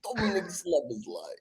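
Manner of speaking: Dominic Szoboszlai in a high Russian accent. Shown as a demonstration. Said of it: "like, a Russian accent".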